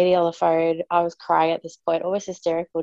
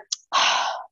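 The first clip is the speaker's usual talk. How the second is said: imitated sigh. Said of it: "I could hear her go".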